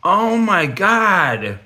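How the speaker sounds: breathless wonder at a gift; amazed gasp. Real, not acted. Awed by gifted dragon piece.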